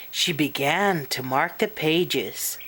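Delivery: ordinary delivery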